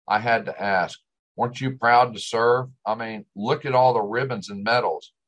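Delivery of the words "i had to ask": ordinary delivery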